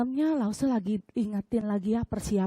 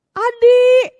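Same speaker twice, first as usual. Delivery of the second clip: high, slow, sweet motherly request; sweet mom; 7 semitones higher, 11 dB louder, slower than usual. Retold or imitated. Mom gently asking child to clean up, sweet. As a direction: coax the child kindly. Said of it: "ngomongnya masih manis".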